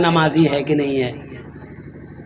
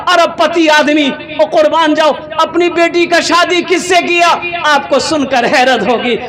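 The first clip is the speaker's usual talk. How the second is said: raised, ringing sermon delivery; impassioned preaching; 6 semitones higher than usual. Acted. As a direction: fire off questions and answers to rouse the crowd. Preaches at full force.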